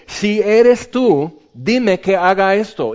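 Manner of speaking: slow, drawn-out plea to Jesus. Retold or imitated. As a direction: stretch each word, asking. Peter's plea retold. (Pedro pide a Jesús, lento y suplicante)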